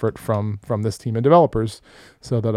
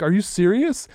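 higher incredulous question; disbelief; 8 semitones higher than usual. Retold or imitated. Told to let it go, asking his boss in disbelief. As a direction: ask it in open disbelief. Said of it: "I'm like".

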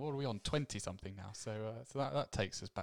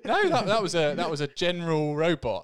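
laughing; 7 semitones higher, 10 dB louder than usual. Real, not acted. Laughing while explaining the impression.

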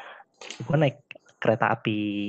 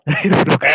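loud laugh; 3 semitones higher, 10 dB louder than usual. Real, not acted. Laughing about seat falling off.

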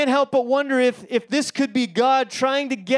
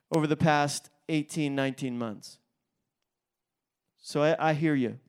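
tender; 7 semitones lower, 8 dB quieter than usual. Real, not acted. Pastor empathizing with tired leaders.

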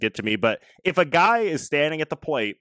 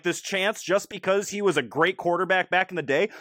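ordinary talk, nothing marked

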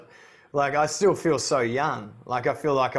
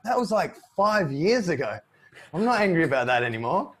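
wife's surprised voice; 5 semitones higher than usual. Retold or imitated. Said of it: "And she's like".

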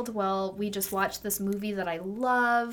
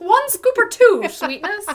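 newsie voice; 12 semitones higher, 11 dB louder than usual. Pretend. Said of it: "newsies from the twenties".

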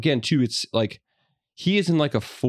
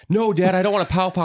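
higher-pitched mock child protest; imitated protesting kid; 9 semitones higher, 4 dB louder than usual. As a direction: play a kid loudly pleading with dad. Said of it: "he started repeating it".

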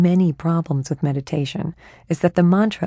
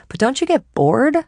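restless plea; 8 semitones higher, 5 dB louder than usual. Acted. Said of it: "I ask".

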